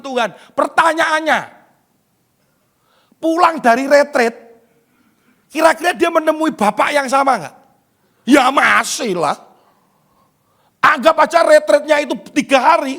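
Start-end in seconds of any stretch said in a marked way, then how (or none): none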